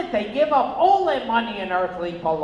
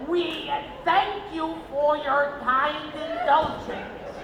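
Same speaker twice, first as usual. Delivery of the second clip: higher, slow, pompous announcement voice; mock formal announcer; 7 semitones higher, slower than usual. Film announcer apologizing to the audience, slow and pompous. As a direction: plead grandly for the audience's patience.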